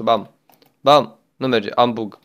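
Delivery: normal talking voice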